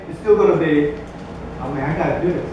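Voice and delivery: normal talking voice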